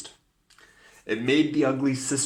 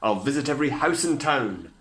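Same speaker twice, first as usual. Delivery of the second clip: loud proclaiming prince; cried; 5 semitones higher, 7 dB louder than usual. A narrator's reading. Prince cries his vow about the slipper. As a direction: proclaim it to the court. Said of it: "he cried".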